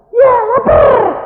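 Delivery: high, loud, drawn-out whine; imitated childish demand; childlike hungry cry. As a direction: wail it like a starving small child. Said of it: "Kayak anak kecil nggak?".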